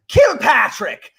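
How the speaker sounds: high, drawn-out mock Irish name call; Irish accent. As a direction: sing out the name in hammed-up Irish. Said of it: "without doing an Irish accent".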